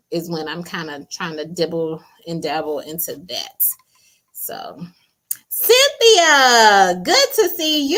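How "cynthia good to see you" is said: high, loud, drawn-out call of the name; excited greeting. Real, not acted. Host greets Cynthia on the live, excited greeting. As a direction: sing out a delighted hello.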